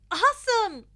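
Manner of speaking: high, loud bubbly shout; excited cheer. Acted. Mina cheering to her friends, high and excited. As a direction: burst out with delight.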